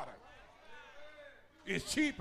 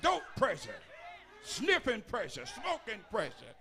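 preaching at full force; 5 semitones higher than usual. Real, not acted. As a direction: hammer each pressure out like a hellfire preacher.

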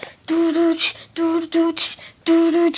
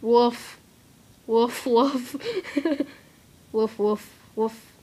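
soft dog woofs; 4 semitones lower, 6 dB quieter, slower than usual. Pretend. Playing a dog filter.

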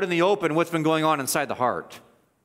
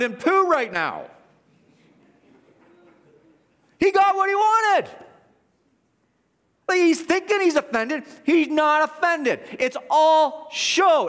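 high, forceful exclaiming; exposing a sham at a raised pitch; indignant preaching; 11 semitones higher, 3 dB louder than usual. Real, not acted. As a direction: cry it out in high-pitched indignation, leaning on the key words. Preacher indignant at the priest's hypocrisy.

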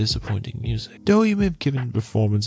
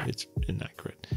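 whisper; 9 dB quieter than usual. Real, not acted. Aside about the film's inaccuracy.

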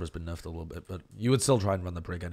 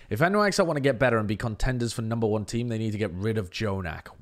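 ordinary talk

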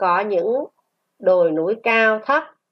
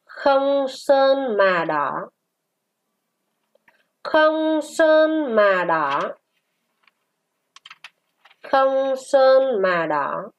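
very slow, drawn-out syllables; model reading; 4 semitones higher, much slower than usual. Shown as a demonstration. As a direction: stretch each word high, then let it fall. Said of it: "Chúng ta đọc". (đọc mẫu thật chậm, kéo dài từng tiếng)

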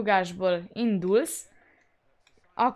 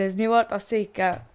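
ordinary talk, nothing marked

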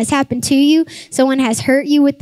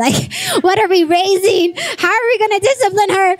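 high-pitched, half-laughing mock panic; pitched-up, playfully distraught questions; retold parental dismay; 8 semitones higher than usual. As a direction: act out a comically alarmed mom. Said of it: "something inside me died".

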